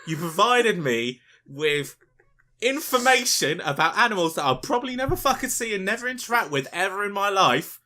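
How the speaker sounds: high, loud outraged tirade; exasperated viewer rant. Acted. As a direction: berate the presenter as a betrayed viewer. Acted viewer berating Attenborough.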